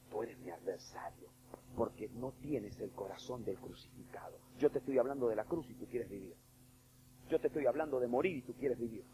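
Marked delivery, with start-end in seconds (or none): 0.1-4.4 soft, low, slow words of reproach; quiet rebuke. Acted. Jesus quietly telling Peter he is his adversary. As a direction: say it gently but gravely.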